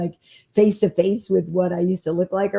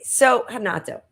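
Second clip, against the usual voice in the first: ordinary delivery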